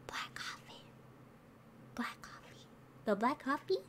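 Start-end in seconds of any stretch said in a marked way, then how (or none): 0.1-2.6 quiet unvoiced try-out; testing the phrase in a whisper. Real, not acted. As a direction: whisper the lyric to yourself. Trying the line under breath.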